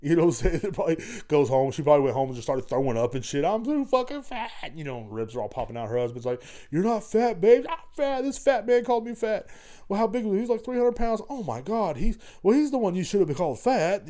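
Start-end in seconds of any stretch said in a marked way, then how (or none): 3.4-4.5 mock upset woman. Imagined woman crying at home. 6.7-14.1 mock couple voices. Imagined husband and wife dialogue.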